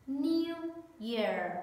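teacher splits "New" and "Year" for copying; split model. Shown as a demonstration. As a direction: break "New" and "Year" apart, rising then falling. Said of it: "listen and repeat after me".

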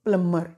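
plumber said plummer, B silent; teacher models plumber without B; correct pronunciation. Shown as a demonstration. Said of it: "बी silent".

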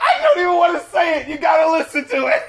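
high, loud, thrilled yelling; excited shout. Real, not acted. Cohost shouting to listeners about the song, excited. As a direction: burst out over the song's best part.

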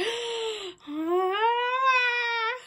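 high teary gasp of joy; overwhelmed happy gasp; tearful gasp. Real, not acted. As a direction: gasp as tears come. Emotional unboxing.

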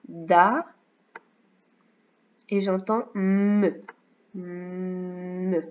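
slow, drawn-out syllables; sounding out syllables. Shown as a demonstration. Teacher sounds out dame for a pupil, slowly. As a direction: stretch out "Da" and "me" as separate sounds. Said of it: "j'entends d".

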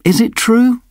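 usual speaking voice

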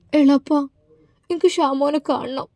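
put-on high, tearful childlike plea; crying to her uncle to see her husband; sobbing like a child. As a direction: whimper it out like a little girl begging. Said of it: "കൊച്ചുകുട്ടിയെ പോലെ നുസൈബ വിതുമ്പുകയാണ്".

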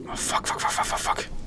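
hushed, voiceless string of curses; panicked under-breath cursing. Real, not acted. Gamer still fighting the leak, whispering in panic. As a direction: repeat it softly under your breath. Muttering as flooding continues.

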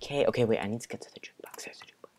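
hushed, repetitive self-talk under the breath; whispering. Real, not acted. Player creeping to the jukebox, whispering. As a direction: whisper as if hiding from listeners. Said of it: "I don't know why I'm whispering".

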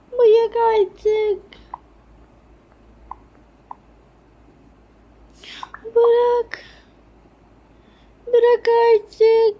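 high, loud pleading shouts; desperate yells. Acted. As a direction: scream at her to let go. Daughter begging to stop.

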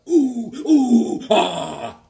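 high, loud hooting; mock hoots. Real, not acted. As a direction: hoot at the joke. Reacts to butt joke.

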